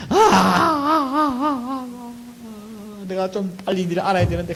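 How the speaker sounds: long drawn-out high sobs, fading into words; acted sobbing wail. Bereaved mourner regretting not knowing sooner, acted sobbing that dies away. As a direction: imitate a mourner's bawling, then let it subside. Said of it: "하면서 막 이제 이제 우는 것이죠".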